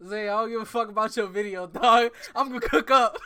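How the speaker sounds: playful trash-talk yell; mock bragging shout. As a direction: hype yourself up, cocky. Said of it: "I remember I was like".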